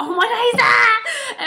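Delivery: high, loud mock-dramatic squeal; mock exclaiming. Friend retells a shocked reaction from the night, mock exclaiming.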